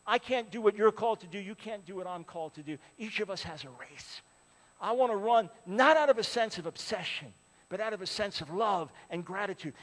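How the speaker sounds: ordinary talk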